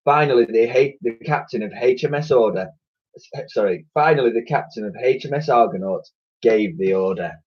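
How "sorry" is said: plain reading voice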